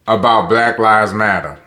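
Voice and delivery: ordinary delivery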